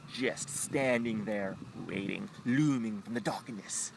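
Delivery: normal talking voice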